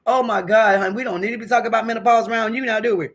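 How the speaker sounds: high, loud, playfully flustered. Real, not acted. Streamer tells a newcomer no menopause talk around him, flustered. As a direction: exclaim it in mock embarrassment. Playful embarrassment as chat member arrives.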